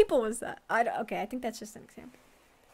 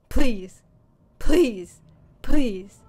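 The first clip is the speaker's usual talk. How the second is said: loud, punched, separate pleas; urgent pleading; 3 semitones lower, 11 dB louder, much slower than usual. Real, not acted. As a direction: beg out loud, word by word. Begging for a game reveal.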